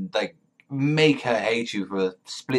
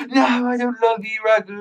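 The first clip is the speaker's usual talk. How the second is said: very high, loud frantic squeal; hammed-up high-pitched frenzy; imitated going crazy; 13 semitones higher, 5 dB louder than usual. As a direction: mimic a girl losing it, pitched way up. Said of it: "just going crazy".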